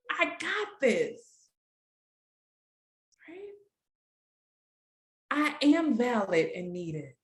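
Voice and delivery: slow, firm first-person affirmations; affirmation read. Shown as a demonstration. Facilitator reading the group's affirmations, slow and firm. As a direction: say each line as a promise to yourself. Reads participants' affirmations aloud.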